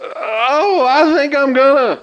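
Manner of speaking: queasy. Acted. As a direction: barely hold back the nausea. About to vomit from spinning.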